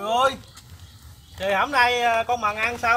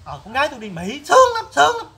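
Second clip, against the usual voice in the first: bragging mother; 5 semitones higher, 8 dB louder than usual. Retold or imitated. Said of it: "má bay chiên ra đi nhiều chuyện".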